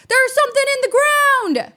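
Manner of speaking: high, loud, excited cry; blurting out that he found something; excited shout. Retold or imitated. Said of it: "He's like".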